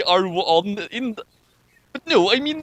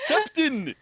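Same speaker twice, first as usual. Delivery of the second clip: high, strained Russian captain cry; Russian accent; 10 semitones higher than usual. Acted. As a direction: shout it like a panicked Russian officer. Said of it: "I don't know if that sounded even remotely Russian".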